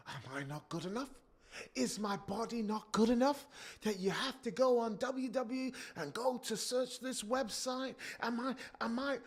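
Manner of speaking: high, pleading wounded wife; hurt wife. Retold or imitated. Wife asking her husband if she's not good enough, high and pleading.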